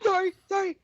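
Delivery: high-pitched, apologetic little voice; Neville apologising. Retold or imitated. Healer sneaking apologies to his partner's victims, apologetic. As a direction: squeak out a furtive apology.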